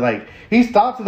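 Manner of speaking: usual speaking voice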